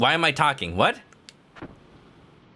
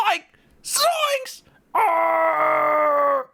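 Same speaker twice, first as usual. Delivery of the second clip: startled outburst; 13 semitones higher, much slower than usual. Acted. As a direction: yelp it out like a spooked cartoon pirate. Comic startled pirate outburst.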